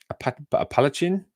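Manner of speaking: tentative, rising "Appalachian"; unsure pronunciation. Shown as a demonstration. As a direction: try "Appalachian" haltingly, asking if it's right. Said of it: "Am I saying that?".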